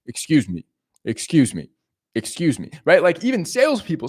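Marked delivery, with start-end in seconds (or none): 0.1-2.7 mildly deadpan, each one falling at the end; understated stone-faced approach, no friendliness. Shown as a demonstration. Coach showing a guy approaching a girl, stone-faced. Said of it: "but you're stone-faced".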